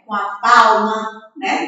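normal talking voice

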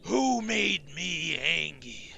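hammed-up slow menacing question; mock menace. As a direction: ham up a villain demanding a culprit. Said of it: "I'm just kidding. That's cringe".

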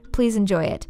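usual speaking voice

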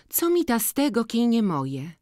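put-on high, wistful snap of a poor girl; sulky young girl. To Boryna, who cannot have the beads, sulky and high. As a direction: toss it off bitterly, a little high. Jagna sulking about the beads.

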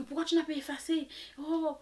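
high, rushed mock reproach; mock dismay. Friend asking why he didn't delete them, mock dismay. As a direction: imitate the friend's lightly panicked high voice.

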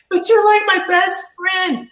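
high, slow, pleading voice; imitating pleading attacker. Retelling the attacker's plea to her, high and slow. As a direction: stretch it out high like a begging man. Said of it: "he goes".

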